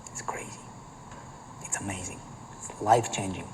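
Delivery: hushed breathy whisper; almost unvoiced, close and secret. Hushed opening lines.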